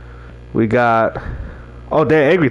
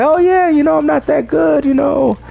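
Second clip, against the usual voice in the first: higher, lighter mock self-deprecation; lightly voiced self-mocking shrug; mock humble; 12 semitones higher than usual. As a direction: breezily play it down.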